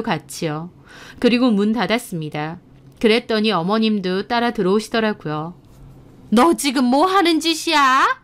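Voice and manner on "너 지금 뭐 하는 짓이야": high-pitched, indignant demand; outraged mother-in-law. Acted. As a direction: demand an explanation from a daughter-in-law. Mother-in-law confronts wife. (호통치는 시어머니 목소리)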